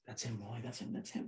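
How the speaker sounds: hushed urgent whisper, mostly breath; whispering to a friend that the thief is here; retold whisper. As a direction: point him out under your breath. Drew whispering, pointing out the thief.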